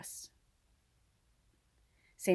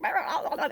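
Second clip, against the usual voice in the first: mock squabbling; 9 semitones higher, 3 dB louder than usual. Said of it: "we're just like".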